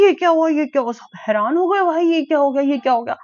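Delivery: high, loud astonished cries; retold shock. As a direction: re-enact the family's disbelief. Relatives shocked at revival. (परिवार वालों की हैरानी भरी ऊँची, तेज़ आवाज़)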